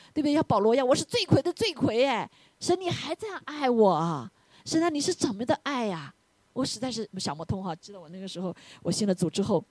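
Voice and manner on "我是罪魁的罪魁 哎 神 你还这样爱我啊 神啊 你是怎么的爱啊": mildly plaintive wonder, higher pitched; high, marvelling appeal to God; acted amazement. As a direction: act out humbled disbelief before God.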